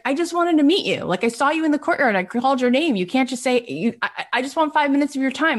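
eager high-pitched pleading; retold breathless-kid enthusiasm; Brian Grazer. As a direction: plead quickly and keenly for a moment of attention. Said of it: "he was so enthusiastic and so cute".

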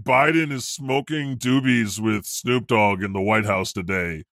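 ordinary talk

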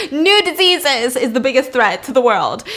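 hammed-up emphatic lecturing; booming declaration. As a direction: announce it like a grave warning. Repeats dad's proclamation after slip.

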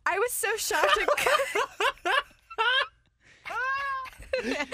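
high, laughing through words; cracking up while talking. Real, not acted. Laughing at the story.

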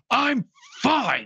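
high, strained mock yell; retold yell. Optimus Prime yelling back at his worried comrades, high mock yell. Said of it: "he just yells at him".

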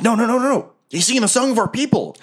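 high, squeaky goblin protest. Acted. Small goblin defending himself to the gruff one, high squeaky voice. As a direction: insist in a high-pitched goblin voice. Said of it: "he goes".

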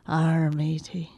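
slow, soft, half-hearted pirate growl; a weak stock pirate, too sick to commit. Acted. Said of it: "I'll do my pirate".

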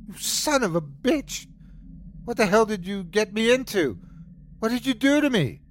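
high furious accusations; raging at the stranger, high and fast at the end; furious yelling. A narrator's reading. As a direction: hurl it at him in rage. Narrator attacks stranger, yelling.

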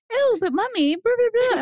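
high mock whiny child voice; whiny kid. Kid whining to mom at camp, high mock whine. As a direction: play the kid pleading with mommy. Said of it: "They'd be like".

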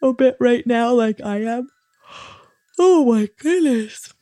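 words swallowed up by a rising yawn; actually yawning. Real, not acted. Host finds herself yawning for real, yawning speech. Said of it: "you're actually yawning".

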